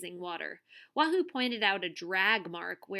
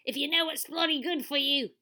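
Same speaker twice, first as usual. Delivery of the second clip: put-on higher Australian-accented snapping threat; barked; 6 semitones higher, 4 dB louder than usual. A narrator's reading. TV star warns intruders off, barked. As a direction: bark it as a rough warning. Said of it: "Derek barked".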